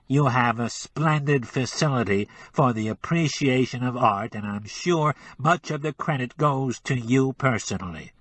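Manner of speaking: pinch the sound into the nose; nasal. Acted. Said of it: "with an adenoidal twang".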